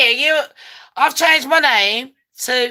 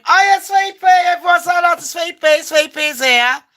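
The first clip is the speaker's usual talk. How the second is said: excited greeting; 6 semitones higher than usual. Real, not acted. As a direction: call out a big welcome. Greeting someone arriving loudly.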